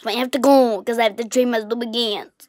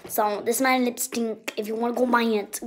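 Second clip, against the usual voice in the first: usual speaking voice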